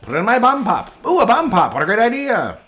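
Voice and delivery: high, loud hammed-up fifties salesman; old-timey gent. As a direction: pitch blue raspberries like a showman from the past. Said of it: "Pretty sure it's not how it sounded in the fifties".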